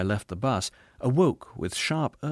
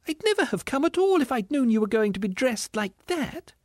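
very high indignant ghost voice; distressed squealing ghost; 13 semitones higher than usual. A narrator's reading. Ghost rebuking the spirit, squealing indignantly. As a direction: plead indignantly, far up high.

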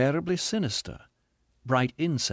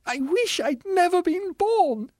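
high, slow anguished wail; anguished ghost; 4 dB louder, slower than usual. A narrator's reading. Ghost after a sobbing snarl, anguished wail. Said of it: "something between a sob and a snarl".

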